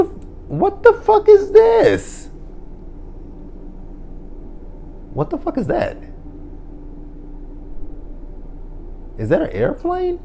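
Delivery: squealed very high and slowly; a high falsetto squeal of shock; shrieking disbelief. Retold or imitated. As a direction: screech in stunned outrage. Imagined reaction to a kiss.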